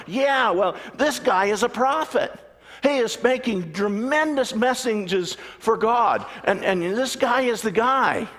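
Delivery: a hammed-up fervent follower; excited congregant. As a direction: sell the prophet hard. Excited congregant praising prophet.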